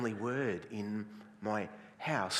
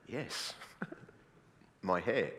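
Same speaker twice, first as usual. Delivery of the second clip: slow, softer, unbothered answer; unfazed reply; 5 semitones lower, 6 dB quieter, slower than usual. Acted. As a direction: answer the challenge slowly and quietly. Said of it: "And I'll go".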